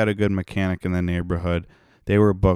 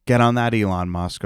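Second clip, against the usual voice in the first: ordinary talk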